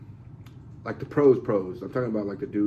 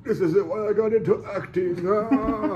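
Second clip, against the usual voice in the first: high, put-on lament of a weary old stage actor; despairing old actor; 8 semitones higher, slower than usual. Ian McKellen breaking down before the tennis ball, high despairing lament. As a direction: wail it like a broken-down veteran actor. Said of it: "he's just like".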